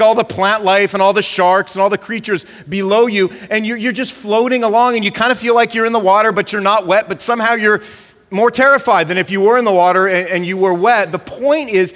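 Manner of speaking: ordinary talk, nothing marked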